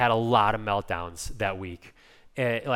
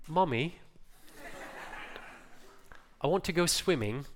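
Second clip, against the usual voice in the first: higher-pitched imitation of a British little boy; British three-year-old; 5 semitones higher, 6 dB quieter than usual. Three-year-old British boy asking his mum to swim, imitated in a higher voice. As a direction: put on a small child's British accent. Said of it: "he's, he's British".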